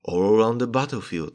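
ordinary talk, nothing marked

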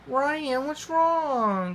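very high, concerned character voice. A narrator's reading. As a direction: ask with high-pitched concern. Friend finds Ryan crying.

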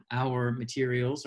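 usual speaking voice

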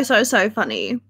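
ordinary delivery

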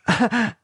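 a high, uneasy little laugh; nervous laugh. Acted. Michelle laughing off her shock to the couple, nervous laugh. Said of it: "she laughed nervously".

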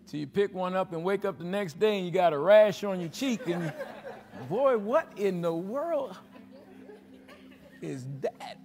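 telling it while laughing; laughing through it. Real, not acted. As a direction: laugh your way through the story. Funny turtle story, laughing.

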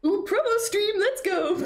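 high-pitched excited cheer. Real, not acted. Streamer hyping a promo stream, excited. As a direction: cheer with a laugh breaking in. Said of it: "I'm excited".

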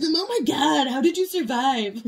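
high, loud, amazed cry; imitating his stunned reaction; mock shock. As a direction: ham up the boyfriend's disbelief. Re-quoting the boyfriend's shock.

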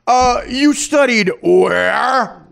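loud, slow mock sarcasm, pitched high; Matthew Perry. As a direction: stretch the question out and swing up at the end. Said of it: "Notice how his voice goes up at the end".

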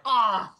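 loud, high mock "ah!"; hammed-up yelp of someone hit; overdone pain cry. Imitates struck man overdoing it.

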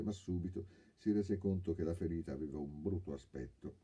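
plain reading voice

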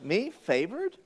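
Mary doubting she is chosen; mock disbelief. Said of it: "And she's like".

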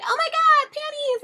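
high, loud squeal; mock swooning women. Women swooning after he sang, high squeal. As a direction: squeal like a smitten fangirl. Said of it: "all the women were just like".

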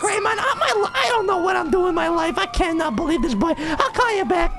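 usual speaking voice

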